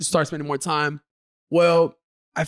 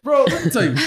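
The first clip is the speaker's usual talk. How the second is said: loud; 8 semitones higher, 4 dB louder than usual. Real, not acted. Venting loudly to friends.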